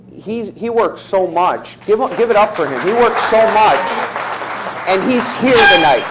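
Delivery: loud, high crowd hype; hyped-up cheer. Real, not acted. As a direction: pump up the audience. Hyping up a hard worker.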